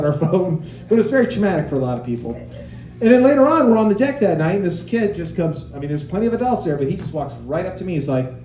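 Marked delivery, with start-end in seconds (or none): none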